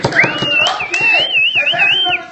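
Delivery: very high, loud excited host; excited squeal. Acted. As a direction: squeal with glee.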